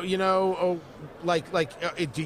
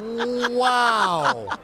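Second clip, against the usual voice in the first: shocked wow; 4 semitones higher, 5 dB louder than usual. Real, not acted. Reacting to diary joke.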